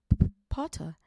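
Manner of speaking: nervous stammer with a loud burst. Acted. Quirrell meeting Harry, nervous stammer. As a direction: stutter the first sound nervously. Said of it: "stammered Professor Quirrell".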